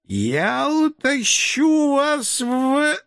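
high, very slow wailing howl; frantic drunken yell. Acted. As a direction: scream it like a madman. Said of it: "истошно вопя". (истошный пьяный вопль)

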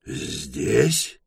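hoarsely voiced startled question. A narrator's reading. Ebling hearing of the Mule's men, hoarse. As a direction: rasp out one disbelieving word. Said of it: "прохрипел он".

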